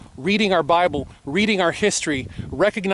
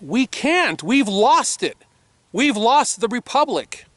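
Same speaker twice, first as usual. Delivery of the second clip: raised, higher ranting yell at the viewers; 7 semitones higher, slower than usual. Real, not acted. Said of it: "Yeah, I'm yelling at you".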